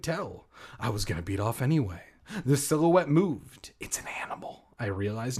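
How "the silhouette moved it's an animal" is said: hushed spooky whisper. Acted. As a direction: breathe it out in a whisper.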